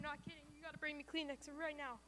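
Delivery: fighting tears with a small teary laugh; choked up. Real, not acted. Choking up, asks for Kleenex.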